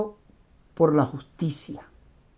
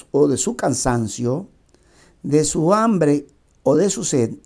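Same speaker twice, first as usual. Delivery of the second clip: firm, declaimed reading in measured phrases; deliberate, projected recitation of a maxim; emphatic book reading; 2 semitones higher, 5 dB louder than usual. Acted. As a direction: read the passage aloud with weight, pausing between phrases. Reads book passage aloud.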